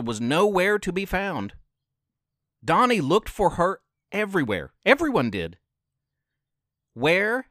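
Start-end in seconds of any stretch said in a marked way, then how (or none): none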